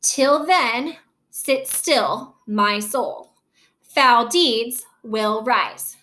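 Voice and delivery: "Till then, sit still" in separate two-beat pairs; iambic stress. Shown as a demonstration. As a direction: lean on "then", "still", "soul", "deeds", "rise". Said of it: "it's gonna be unstressed, stress".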